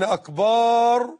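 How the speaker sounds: «أكبر» held long as «أكبار»; wrong long vowel. Shown as a demonstration. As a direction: stretch the last vowel of «أكبر» on a level note. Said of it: "هو الطبل". («أكبر» بمدّ الباء: «أكبار»)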